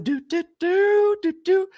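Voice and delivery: high sing-song "do" in a put-on voice; mocking, high-pitched crooning imitation; Missus Herbert. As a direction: lilt it way up high. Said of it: "Y'all heard how she was sounding".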